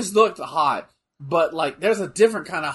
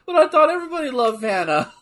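Michelangelo; 7 semitones higher, 3 dB louder than usual. Retold or imitated. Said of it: "then Michelangelo goes".

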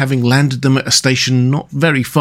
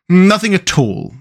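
firm; 7 semitones higher than usual. A narrator's reading. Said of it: "replied the Rat firmly".

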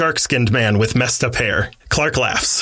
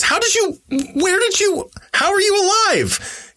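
high, stammering shocked cry; shocked disbelief. A narrator's reading. Lois stunned Clark survived.

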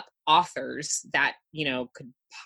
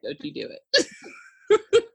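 mock warning; much slower than usual. Warning husband with a look.

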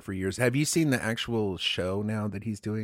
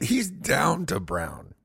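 slow, higher, savoring each word; drawn-out echo; 4 semitones higher, much slower than usual. Pretend. Cohost echoing the verdict on Tom Six, drawn-out echo. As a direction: stretch out DOWN and BROWN playfully.

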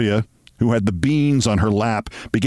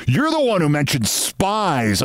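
high-pitched, accusing retort; mildly angry; 8 semitones higher than usual. A narrator's reading. Narrator retorting to Sylvia, indignant. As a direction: throw the blame back, pitch raised. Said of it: "That made me angry".

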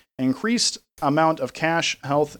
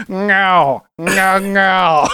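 loud laughing meows as a sound effect; cat meow noises; 6 semitones higher, 7 dB louder, much slower than usual. Acted. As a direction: meow it out louder through a laugh. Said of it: "What noise do I make for".

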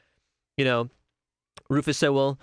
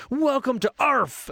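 much higher, slow, hammed-up movie-hero delivery; Will Smith quote; 10 semitones higher, slower than usual. Fan quoting Independence Day, hammed-up Will Smith voice. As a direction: belt it like the punchline of a blockbuster. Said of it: "there's so many great Will Smith lines".

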